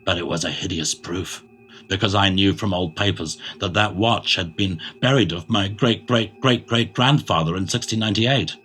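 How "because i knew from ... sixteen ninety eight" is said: ordinary delivery, nothing marked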